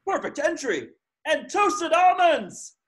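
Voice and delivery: high, thrilled sports call; excited announcer. Acted. Announcer calls the perfect dive, high and excited.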